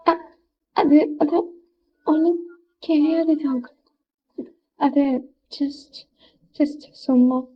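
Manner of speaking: a hint of a girl who has just been crying, softly; teary hesitant girl. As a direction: hold back tears while brushing it off. Crying girl answers Jungkook softly.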